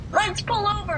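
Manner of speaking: very high mock holler; calling out. Stranger urges driver to stop, calling out. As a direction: pitch it way up to carry across.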